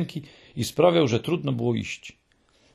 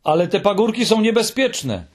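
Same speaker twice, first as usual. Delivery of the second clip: a high, loud cry of warning; shout; 9 semitones higher, 4 dB louder than usual. A narrator's reading. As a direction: blurt out the warning, much higher and louder. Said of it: "więc krzyknął". (krzyk Muminka: niebezpieczne)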